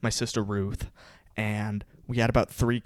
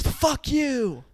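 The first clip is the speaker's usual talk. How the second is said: high-pitched drawn-out mock yell, held back in volume; 12 semitones higher than usual. Lady yelling at dad through car window, high mock yell. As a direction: imitate a yelling woman way up high. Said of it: "then this lady yells".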